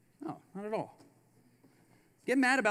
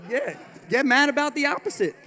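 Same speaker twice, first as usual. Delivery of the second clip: higher and louder get mad about the opposite; emphatic shout; 6 semitones higher, 8 dB louder than usual. Real, not acted. To the audience, a high loud emphatic call. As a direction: belt it out at the crowd.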